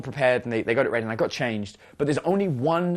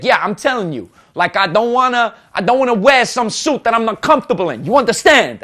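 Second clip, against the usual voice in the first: loud, higher-pitched East Coast tough guy; aggressive meathead; 6 semitones higher, 7 dB louder than usual. Retold or imitated. Meathead from New Jersey talking to his friend, aggressive. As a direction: imitate a big aggressive guy mouthing off. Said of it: "he's, like, speaking like that".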